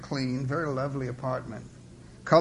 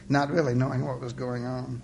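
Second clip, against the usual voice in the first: plain reading voice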